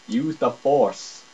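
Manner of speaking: normal talking voice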